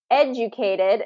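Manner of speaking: "educated" with a clear beat on ED; first-syllable stress. Shown as a demonstration. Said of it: "is first syllable stress".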